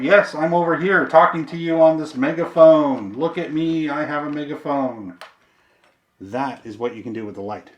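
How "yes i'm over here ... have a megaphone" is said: loud, raised attention-getting call; calling out by megaphone. Shown as a demonstration. Demo attention call through the megaphone.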